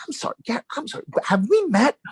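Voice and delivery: high-pitched, incredulous interruption; retold bewilderment. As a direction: act out total confusion at a stranger. Retold confusion at stranger's familiarity.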